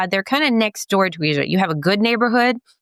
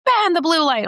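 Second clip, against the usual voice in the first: mock patriot; 10 semitones higher than usual. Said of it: "it's like".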